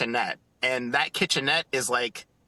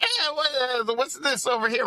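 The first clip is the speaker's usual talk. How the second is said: put-on high, poking-around kid voice, much higher; curious little son; 10 semitones higher than usual. Son in Walt's apartment asking about things, high kid voice. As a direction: ask it like a kid who wants to touch everything. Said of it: "And he was like".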